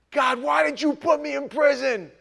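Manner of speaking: loud, high, indignant demand; retold outraged cry to God; angry complaint to God. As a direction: yell the question up at heaven.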